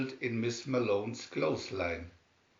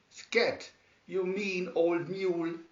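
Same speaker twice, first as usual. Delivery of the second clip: higher, cross shout at the mule; 6 semitones higher than usual. A narrator's reading. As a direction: chase the mule away. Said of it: "she shouted".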